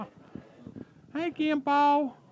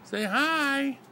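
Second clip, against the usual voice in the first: usual speaking voice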